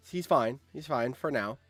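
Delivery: ordinary talk, nothing marked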